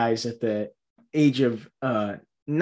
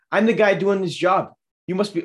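imitating Wahlberg; 7 semitones higher, 4 dB louder than usual. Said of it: "Mark Wahlberg goes".

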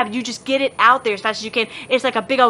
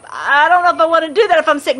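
higher, quick, slightly louder mock objection; doubting teen; 6 semitones higher, 5 dB louder, faster than usual. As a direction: rattle off a sceptical teen's worry. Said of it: "Storm was like".

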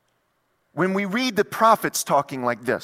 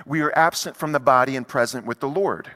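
ordinary delivery

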